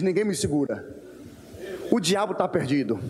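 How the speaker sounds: lightly voiced boastful believer, low and cocky; a hint of a swaggering churchgoer. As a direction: brag like someone who feels unstoppable. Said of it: "a gente acorda inspirado e diz".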